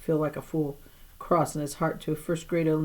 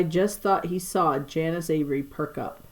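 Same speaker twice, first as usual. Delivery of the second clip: plain reading voice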